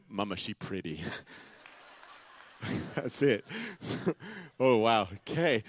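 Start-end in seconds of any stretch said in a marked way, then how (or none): 0.1-1.0 lower, much softer and hushed, lightly voiced little boy; awed toddler. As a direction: murmur it like a smitten two-year-old. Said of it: "He just said".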